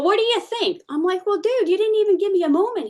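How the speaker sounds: high, caught-off-guard reply; flustered. Retold or imitated. Employee stunned by a sudden raise talk. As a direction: sound put on the spot.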